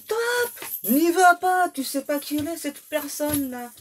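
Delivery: warning friends. Retold or imitated. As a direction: shout him off her like alarmed friends. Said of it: "qui ont dit".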